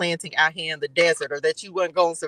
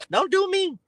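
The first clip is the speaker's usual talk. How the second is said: sassy warning; 12 semitones higher than usual. Pretend. Sassing the spirits' attitude.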